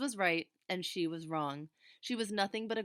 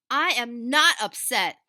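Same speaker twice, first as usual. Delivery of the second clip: slow, very loud, higher yell; 6 semitones higher, 14 dB louder, slower than usual. A narrator's reading. Angry girl yelling at her sympathetic mother, loud. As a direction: scream it, defiant. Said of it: "yelled Ramona".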